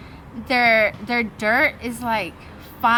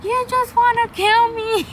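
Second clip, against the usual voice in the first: high, loud, strained mock wail; mock wailing yell; 12 semitones higher, 5 dB louder than usual. Exhausted on the run, yelling at her trainer, high wailing yell. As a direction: whine it out like she's killing you. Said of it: "So I'm yelling".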